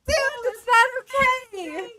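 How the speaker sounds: high, loud, slow protest; indignant. Retold or imitated. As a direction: scold him, offended. Scolding the caller.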